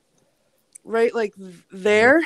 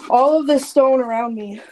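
usual speaking voice

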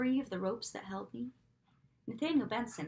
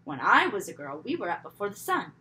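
lecturing mother; 9 dB louder than usual. A narrator's reading. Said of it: "Her voice droned on and on".

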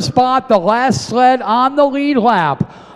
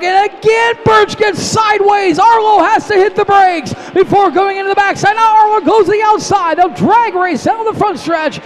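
excited race call; 8 semitones higher than usual. Real, not acted. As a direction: call the lead change excitedly.

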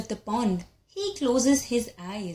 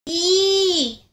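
sharp high yelp; startled squeal from the cat; cat's yelp; 8 semitones higher, 5 dB louder than usual. Acted. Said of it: "yelps Cat".

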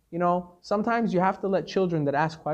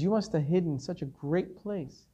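soothing reassurance; 8 dB quieter than usual. Retold or imitated. Soothing the crying child.

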